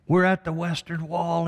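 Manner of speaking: mild mock of a moved commander crying out; emotional commander. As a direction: press it home, overwhelmed with joy. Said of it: "Starts to weep as he says".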